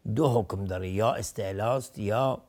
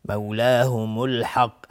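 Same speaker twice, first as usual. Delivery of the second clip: slow, measured recitation with long vowels; Quran recitation; 7 dB louder, slower than usual. Shown as a demonstration. Teacher reciting the next words, slow Quran recitation. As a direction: recite slowly, drawing out each long vowel. Recites the verse being taught.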